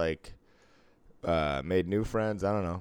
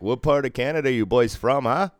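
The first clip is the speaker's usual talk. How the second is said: put-on loud, higher-pitched Canadian accent; 6 semitones higher, 7 dB louder than usual. Needling Canadian hockey fans, in a put-on Canadian accent. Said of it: "That's how you do a good accent".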